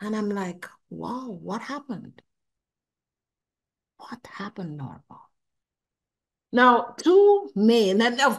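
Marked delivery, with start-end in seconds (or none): 0.9-2.2 slow, soft, stunned murmur; hushed wonder. Retold or imitated. Speaking to herself, awestruck at the change, hushed and slow. 4.0-5.2 breathless unvoiced whisper. Asking herself what changed, in a stunned whisper.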